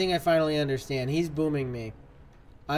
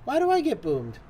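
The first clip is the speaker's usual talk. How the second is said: much higher, frustrated cry of why; real gamer frustration, pitched way up; exasperated whine; 11 semitones higher than usual. Real, not acted. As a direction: whine it up high in disbelief. Frustrated at blowing himself up.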